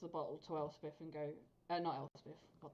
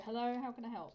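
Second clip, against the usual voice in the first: higher, louder, brightly polite door-answer; put-on helpful voice at the door; overly polite greeting; 6 semitones higher, 5 dB louder than usual. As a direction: greet the visitors with a sweet, innocent act. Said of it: "And go".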